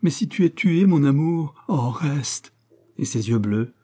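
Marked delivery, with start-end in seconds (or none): 0.0-2.5 higher-pitched plea, easing at the end; pleading. A narrator's reading. Woman begs her lover not to fight, pleading. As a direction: beg the lover to stay. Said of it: "plus encore que ses paroles, le suppliaient".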